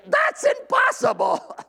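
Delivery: high, strained cry of protest; acted inner outcry. Preacher voices his insides objecting to giving up anger, high exasperated outcry. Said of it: "my insides cry out and say".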